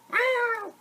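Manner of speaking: high squeaky cat meow; kid-friendly kitty meow. A narrator's reading. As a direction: mew like a little cat. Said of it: "The cat meowed".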